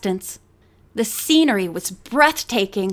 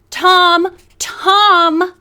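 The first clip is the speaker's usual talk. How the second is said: calling out; 6 semitones higher, 7 dB louder than usual. Acted. Woman calls across pier.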